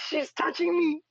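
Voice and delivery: high, loud playful cry; mock yell. Streamer repeating her playful complaint, high and loud. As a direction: call out in laughing mock alarm.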